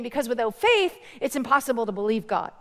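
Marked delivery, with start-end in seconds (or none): none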